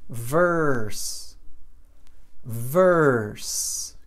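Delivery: "Verse" drawn out on the R; long, falling "Verse" with a strong English R; same R sound. Shown as a demonstration. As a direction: hold the R as the only vowel in "Verse". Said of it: "mesmo som".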